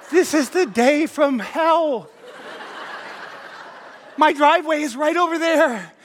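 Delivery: high, anguished comic outburst; exasperated. Retold or imitated. Driver stopped near home, wailing in exasperation. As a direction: lament it as the last straw. Despair at being pulled over.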